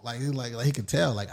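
usual speaking voice

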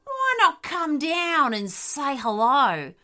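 loud, taunting predator voice; wicked dingo. A narrator's reading. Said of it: "The dingoes laughed a wicked laugh".

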